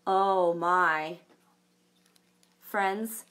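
ordinary delivery, nothing marked

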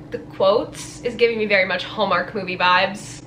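ordinary delivery

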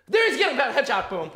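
loud laughing victory yell; excited shouting. Real, not acted. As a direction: scream with delight at the headshot. Spotting and killing last enemy.

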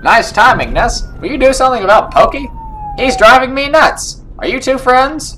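high, loud cartoon pleading; Terrence and Phillip voice. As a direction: squawk it as an exasperated cartoon cop. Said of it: "give him the Terrence and Phillip voice".

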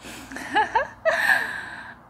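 high, breathy villain giggle; unhinged little laugh; creepy laugh. Acted. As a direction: giggle with sinister glee. Villain laughs at trapped patient.

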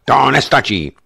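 heated insistence; insistent protest. A narrator's reading. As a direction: keep pressing the point. Lockhart keeps arguing.

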